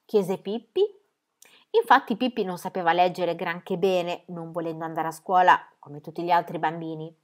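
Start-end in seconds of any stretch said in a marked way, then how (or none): none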